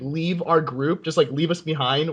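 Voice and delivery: normal talking voice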